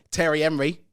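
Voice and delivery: Anglicised "Thierry Henry" in mock Cockney; Cockney accent. Shown as a demonstration. Joking with co-host about French names, mock Cockney accent. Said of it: "Cockney accent at the end of it".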